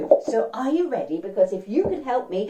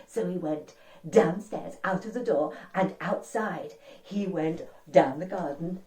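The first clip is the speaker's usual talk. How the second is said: ordinary delivery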